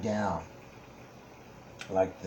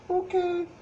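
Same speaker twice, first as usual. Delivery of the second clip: put-on high little kid voice; squeaky puppet child. Timmy puppet answers.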